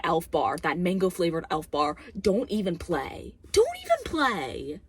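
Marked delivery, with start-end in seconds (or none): 3.5-4.8 high, drawn-out emphatic warning; indignant, slow high-pitched repeat; escalated exasperated warning. Real, not acted. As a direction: raise the pitch and stretch it out in exasperation.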